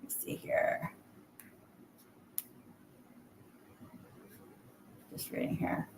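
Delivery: reading under breath; whispering. Real, not acted. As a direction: murmur while reading comments. Reading comments under her breath.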